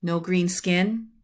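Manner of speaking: usual speaking voice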